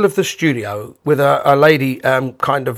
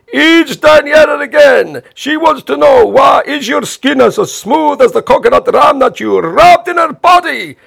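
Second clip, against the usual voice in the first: put-on high, loud, slow Italian-accented taunt; shouting Italian host; 11 semitones higher, 5 dB louder than usual. Host taunting Bob with the email, loud gleeful shout. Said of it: "And he went".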